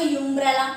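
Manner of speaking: यूम्ब्रेला with a "you" at the front; wrong pronunciation. Shown as a demonstration. Teacher quoting a pupil's error, यूम्ब्रेला mispronounced with "you". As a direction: misread यूम्ब्रेला as "YOOM-brella". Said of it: "that is तो wrong".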